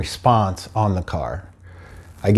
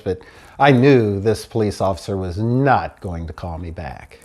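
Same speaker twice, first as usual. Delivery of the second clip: normal talking voice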